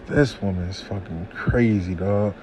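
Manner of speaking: quiet disbelieving mutter; muttered aside. Retold or imitated. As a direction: mutter it to yourself, defeated. Muttering to himself after sighing.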